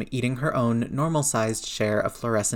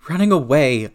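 loud, raised panicked yell; 4 semitones higher, 9 dB louder than usual. A narrator's reading. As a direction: yell it out, higher and louder. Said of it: "his brain yelled".

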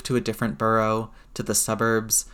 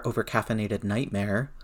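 ordinary delivery, nothing marked

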